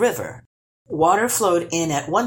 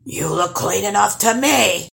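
objecting troll; 6 semitones higher, 5 dB louder than usual. Acted. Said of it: "objected the troll".

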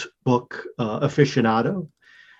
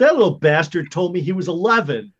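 mock outrage; 8 semitones higher, 3 dB louder than usual. Tenant outraged at boy's lie.

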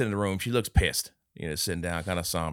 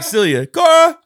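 loud, high call across a yard; 16 dB louder, slower than usual. Retold or imitated. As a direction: yell the names to find the dog. Calling for the lost dog.

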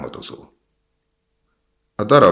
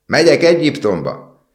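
high, loud excited cry; raised, ringing announcement; shouting out; 11 semitones higher, 9 dB louder than usual. A narrator's reading. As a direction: call it out over the noise. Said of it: "kiáltott a fecske".

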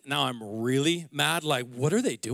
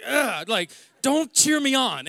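higher, louder grumpy brush-off; annoyed; 8 semitones higher, 4 dB louder than usual. Retold or imitated. Retelling how he snapped at his cheering family, mock irritated. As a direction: wave them away with a mock snarl. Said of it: "I'm like".

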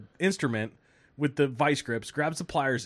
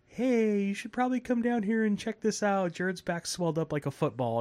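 mom on phone; 8 semitones higher than usual. Retold or imitated. Mom calling the doctor.

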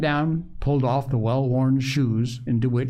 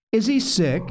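higher, awed question; 7 semitones higher than usual. A narrator's reading. Polly asking her mother about the parson, awed. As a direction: ask it with a touch of awe. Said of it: "asked Polly in awe".

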